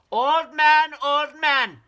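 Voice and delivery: high, loud shout. Acted. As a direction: call out loudly. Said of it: "shouted Chang".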